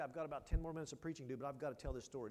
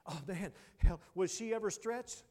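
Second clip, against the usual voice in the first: loud preacher's exclamation; 4 semitones higher, 12 dB louder than usual. Acted. Preacher crying out about her trial, loud. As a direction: burst out loud on oh man.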